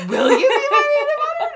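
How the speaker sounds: high, loud, fast laughing mock question; mock squeaky ask. Quoting request again.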